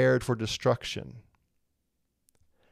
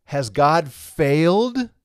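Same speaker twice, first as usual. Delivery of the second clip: slow, drawn-out worried "Has God failed?"; anxious question; 4 dB louder, much slower than usual. Pretend. Teacher voicing an objector to Paul, slow worried question. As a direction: voice the objector asking "failed?" slowly. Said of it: "All of this provokes the question".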